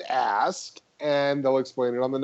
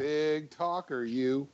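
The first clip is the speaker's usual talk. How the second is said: teasing; 8 dB quieter, slower than usual. Real, not acted. Teasing, slow and soft.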